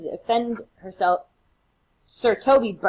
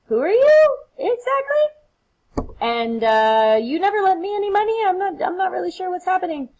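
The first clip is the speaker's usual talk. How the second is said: much higher, baffled disbelief; acted bewilderment; 12 semitones higher, 4 dB louder than usual. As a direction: act utterly clueless about the stranger. Cesario denies knowing Antonio.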